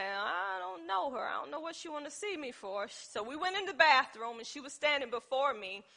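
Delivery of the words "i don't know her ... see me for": quietly baffled, as if thinking aloud; wary inner thought. Retold or imitated. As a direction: retell a private doubt, hushed. Puzzled inner reaction to stranger.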